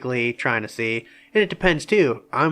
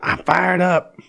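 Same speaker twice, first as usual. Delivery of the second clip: higher-pitched show of being pumped; fired up; 7 semitones higher, 4 dB louder, slower than usual. Pretend. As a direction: put on some hype to prove it. Answers the challenge to sound stoked.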